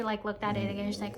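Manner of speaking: ordinary talk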